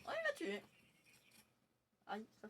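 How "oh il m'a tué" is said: high-pitched startled cry; high yelp. Real, not acted. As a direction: yelp as you get killed.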